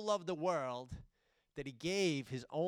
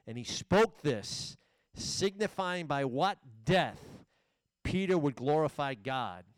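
full-force preaching; 2 semitones higher, 5 dB louder than usual. Acted. Preacher voicing Jesus' prophecy to Peter, full-force and loud. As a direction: proclaim it to the back row, slowing on the key words.

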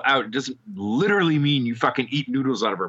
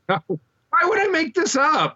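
high, drawn-out protest; indignant, voice shooting way up; much slower than usual. Real, not acted. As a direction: squeal it in disbelief at being doubted. Indignant at being disbelieved.

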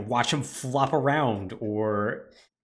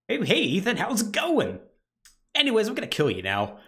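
cheery killer Jack; 9 semitones higher than usual. Retold or imitated. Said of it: "He's like".

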